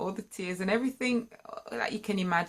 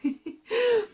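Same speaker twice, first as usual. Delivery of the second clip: high laugh; 7 dB louder than usual. Real, not acted.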